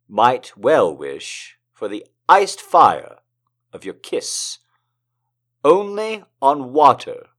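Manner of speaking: slow, lingering lyrical reading; poetry reading. Acted. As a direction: savor the repeated images. Reads a poem aloud.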